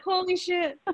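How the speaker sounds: high, amazed outburst; delighted amazement. Real, not acted. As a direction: gasp it out, thrilled and stunned. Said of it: "let me stop yelling".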